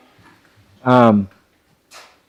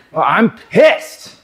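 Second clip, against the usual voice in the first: high, loud, slow mock anger; mock angry; 8 semitones higher, 7 dB louder, slower than usual. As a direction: ham up being pissed off. Acting out an angry statement.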